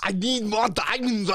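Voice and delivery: slow, hammed-up movie villain; Mister Freeze. Co-host mocking the diamond plot, hammed-up villain voice. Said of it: "Fucking Mister Freeze".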